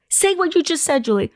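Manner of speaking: high-pitched, insistent retold demand; acting out breathless insistence; excited demand. Urging friend to repeat the news.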